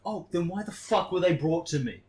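high, loud exasperated comeback; retold indignant retort. To Glenn, the ops manager, loud exasperated retort. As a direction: fire it back at him. Arguing with Glenn over paintbrushes.

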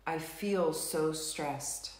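slow, measured setup phrases with long pauses; tapping script read. Acted. Tapping leader reads the setup statements to the group, slow and measured. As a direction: guide the group through each tapping statement.

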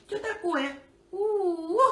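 high whiny pet voice; squeaky voice. Retold or imitated. Owner squeaks pet's whine.

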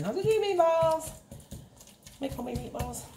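mock her own earlier plan; mock self-quote. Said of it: "I got lazy and said".

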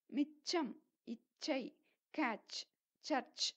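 மிச்சம், இச்சை, கேட்ச் with breathy chh; aspirated ch. Shown as a demonstration. Teacher giving examples, மிச்சம் and சர்ச் with chh. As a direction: puff air on ch in மிச்சம், சர்ச். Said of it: "அதுதான் சரியான pronunciation".

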